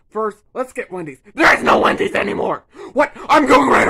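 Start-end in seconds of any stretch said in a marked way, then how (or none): 1.3-2.6 higher, strained outburst; Donkey yelling at Shrek; exasperated shout. Acted. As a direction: blurt it in disbelief.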